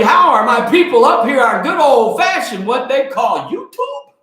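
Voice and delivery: loud, high holler; shouted call-out. Real, not acted. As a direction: hype up the audience at full volume. Calling out to YouTube viewers.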